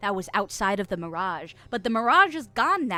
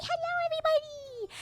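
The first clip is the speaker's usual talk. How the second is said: squealed greeting. Pretend. Streamer greeting everybody in chat, high squealed hello. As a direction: squeal it out to the crowd.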